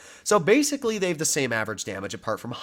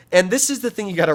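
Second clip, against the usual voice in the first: voice crack; 7 semitones higher, 6 dB louder, faster than usual. Real, not acted. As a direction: voice cracking mid-sentence. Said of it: "sorry about that voice crack there".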